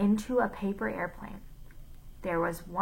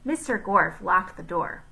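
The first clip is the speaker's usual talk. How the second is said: ordinary delivery, nothing marked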